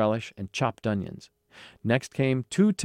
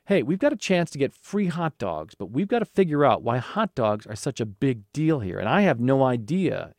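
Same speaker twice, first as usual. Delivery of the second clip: ordinary delivery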